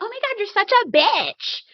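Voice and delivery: high-pitched mock exasperation; exaggerated high-voiced disgust; imitating an appalled castmate. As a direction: exclaim it high, hamming up the outrage. Acts out Crystal's exclamation.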